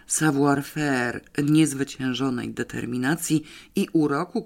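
ordinary delivery

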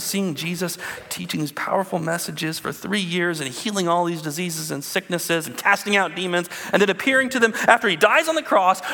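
ordinary talk, nothing marked

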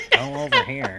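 very high, nasally mock sidekick voice; Krillin. Friend joining in on the Krillin voice joke, laughing imitation. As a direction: squeak it out through a laugh. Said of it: "like, nasally, gra-, raspy voice".

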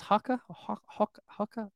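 unsure repeats of "Hoka" with an open vowel; attempted British Hoka. Shown as a demonstration. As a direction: try out "Hoka" like "hocka", faltering. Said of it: "I can't even".

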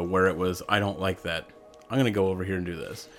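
ordinary talk, nothing marked

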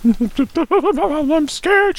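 hammed-up sobbing girl voice. A narrator's reading. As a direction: whimper it like a frightened girl. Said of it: "she sobbed".

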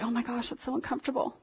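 very soft, quick imitation of uncomfortable onlookers; mock uneasy aside. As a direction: say it fast under your breath, like an uneasy bystander. Voicing uneasy onlookers at church.

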